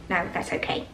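"No, that's okay" near-whispered, offhand; polite soft refusal. Shown as a demonstration. Declining a friend's offer of a ride, softly. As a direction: turn down the ride gently. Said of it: "do you need a ride home".

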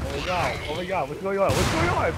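rising panicked cry, climbing high; being chased. Real, not acted. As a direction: panic building as chaos hits.

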